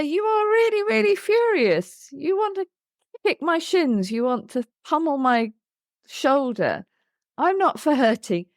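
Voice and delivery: put-on high, intense voice that names the child's fury; matching the child's rage. Shown as a demonstration. Therapist mirroring a furious child's rage, in an intense high voice. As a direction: meet the child's anger at full pitch. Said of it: "but I raise my voice".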